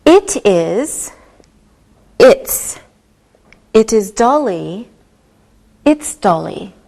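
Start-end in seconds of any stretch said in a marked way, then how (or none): none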